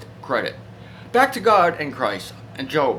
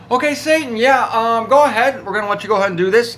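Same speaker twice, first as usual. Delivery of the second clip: higher, louder, fast fake-agreeable permission; mock-indulgent; 6 semitones higher, 7 dB louder than usual. God loudly humouring Satan, mock-agreeable and fast. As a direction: humour Satan with exaggerated approval.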